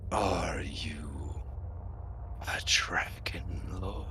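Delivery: low, breathy ghost whisper; raspy ghostly voice. Acted. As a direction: rasp it out like a ghost. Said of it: "in a kind of raspy, ghostly voice".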